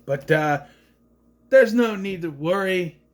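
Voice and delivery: ordinary talk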